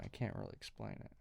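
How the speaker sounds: mumbled aside. Real, not acted. Muttered aside, barely voiced.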